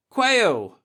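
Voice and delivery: high, loud blurt; drunken reply. Acted. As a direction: blurt the name out, tipsy. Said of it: "Nagurski replied".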